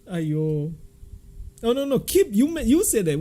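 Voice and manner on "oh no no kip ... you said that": excited protest. Real, not acted. Reacting to absurd story.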